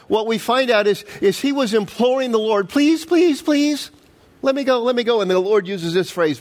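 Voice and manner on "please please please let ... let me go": high pleading please please; mock begging child. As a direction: beg the Lord, pitched up. Begging plea voiced in retelling.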